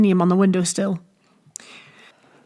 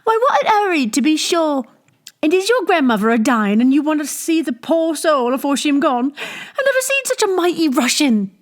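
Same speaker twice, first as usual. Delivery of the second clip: much higher, mock-astonished rustic teasing; teasing barmaid; 8 semitones higher than usual. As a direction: poke fun at the hurried drinker. Teasing a thirsty patron.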